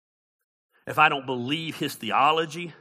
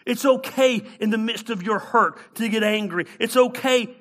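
high, louder, emphatic reassurance from the pulpit; impassioned preaching; 8 semitones higher, 7 dB louder than usual. Real, not acted. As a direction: preach the permission with a raised voice.

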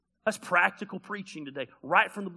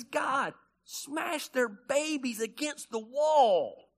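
slow, higher, drawn-out angry demand; angry prayer; 7 semitones higher, much slower than usual. Acted. David telling God what to do to his enemies, slow and angry. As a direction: stretch it out like a furious plea. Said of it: "He was mad".

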